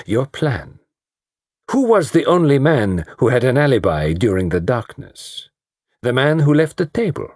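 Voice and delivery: put-on high, grand French-accented detective; Poirot savouring his moment, French accent. Poirot accuses the murderer.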